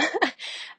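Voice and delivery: a quick airy chuckle; short breathy laugh. Real, not acted. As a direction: laugh lightly at yourself. Laughs at own routine.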